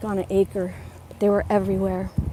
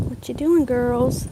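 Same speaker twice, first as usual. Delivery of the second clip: pet talk; 3 semitones higher, slower than usual. Real, not acted. Talking to her dogs.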